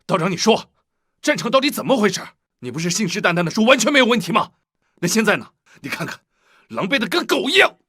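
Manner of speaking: high-pitched, agitated reproach; angry scolding. Acted. Commander blaming the Taoist for the defeat, angry.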